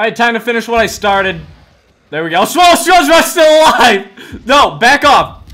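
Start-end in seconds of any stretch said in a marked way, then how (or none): 2.4-5.4 high, loud alarmed shouting. Real, not acted. Gamer spots zombie SpongeBob alive, alarmed shout. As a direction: yell at the zombie to back off.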